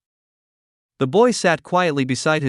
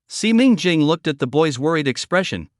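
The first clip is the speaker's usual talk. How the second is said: usual speaking voice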